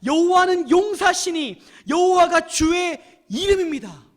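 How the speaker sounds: slow, loud, high-pitched exultant reading; overflowing emotion. Shown as a demonstration. Preacher reading verse three of Moses' song, overflowing emotion. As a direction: declare each line triumphantly. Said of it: "이 정서를 가지고 한번 읽어보세요".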